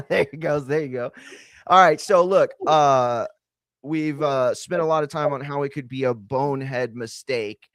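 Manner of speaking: ordinary delivery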